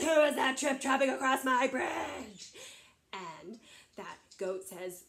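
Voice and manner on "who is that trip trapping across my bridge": loud, challenging troll shout; big troll. Acted. Big troll challenging the medium goat, shouting. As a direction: bellow it as the bridge's troll. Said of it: "that big troll says".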